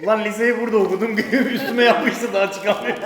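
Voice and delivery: laughing while talking. Real, not acted. Laughing through answer.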